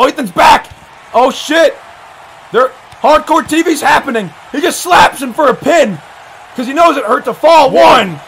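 lively wrestling commentary; excited play-by-play. Real, not acted. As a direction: call the action as it breaks.

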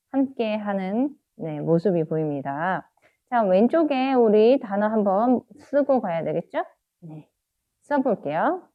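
ordinary talk, nothing marked

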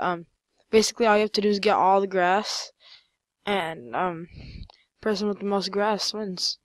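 usual speaking voice

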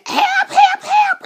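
very high, loud imitated cry for help; mock screaming. Doll protesting being squeezed too tight, mock screaming. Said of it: "She was, like, screaming".

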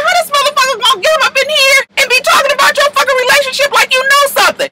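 high, fast mock rage; mock furious. Jessica confronting Treasure, high fast mock rage. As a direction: tear into her fast. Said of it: "Jessica Dye was like".

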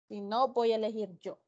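ordinary delivery

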